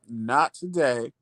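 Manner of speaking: drawn-out not today; slow, firm brush-off; slow sassy refusal. Retold or imitated. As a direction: take it slow and final. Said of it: "I was like, "Child".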